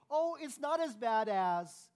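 high, loud, slow mock brush-off; dismissive brush-off. Shown as a demonstration. Pastor mocking a brush-off to the congregation, hammed up. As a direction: wave the trouble away, drawn out and loud.

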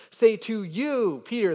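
"say to you" slow, high and loud; emphatic stress. Shown as a demonstration. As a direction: stretch "you", then punch "Peter". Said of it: "notice the emphatic emphasis".

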